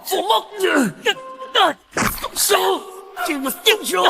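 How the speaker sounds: high, strained yelling; defiant shouting. Acted. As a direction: yell like your life depends on it. Grappling with enemy, refusing to yield.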